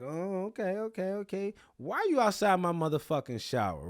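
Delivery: mock challenge. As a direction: act out an animated, high-pitched confrontation. Retold confrontation at shower.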